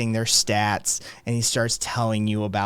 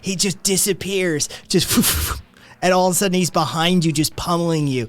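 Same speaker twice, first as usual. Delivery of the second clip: rising, higher and higher awestruck fan talk; gushing fan; 5 semitones higher than usual. Acted. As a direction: build up the champion like a legend. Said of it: "he's like".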